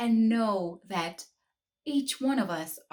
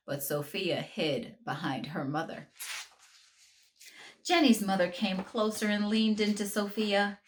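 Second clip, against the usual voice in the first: ordinary delivery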